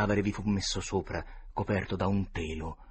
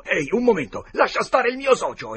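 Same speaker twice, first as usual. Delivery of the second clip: higher and louder, a sudden shout; alarmed exclaim; 13 semitones higher, 11 dB louder than usual. A narrator's reading. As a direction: jump in to stop the fight. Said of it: "ed esclamò". (Potter grida al dottore di lasciare stare il socio)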